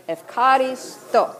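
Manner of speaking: "efkaristo" broken up, ef-ka-ri, then STO; teaching Greek pronunciation. Shown as a demonstration. Preacher drilling congregation on "efkaristo", careful ef-ka-ri-STO. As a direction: say "efkaristo" in careful pieces for repeating. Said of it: "Let's try those words".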